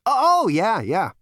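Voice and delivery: high, loud swaggering voice; cocky Zaphod. A narrator's reading. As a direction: brag like a smug hero. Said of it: "Zaphod answers".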